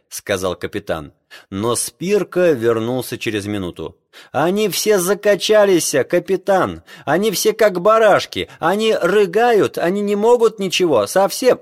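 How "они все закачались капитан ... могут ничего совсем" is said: high, loud alarmed report; panicked shouting. Acted. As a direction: blurt out in alarm. Panicked sailor reports to captain.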